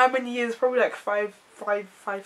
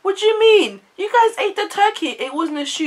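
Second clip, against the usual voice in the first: much higher, louder auntie retort; translating auntie; 12 semitones higher, 8 dB louder than usual. Retold or imitated. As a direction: fire it back high and incredulous.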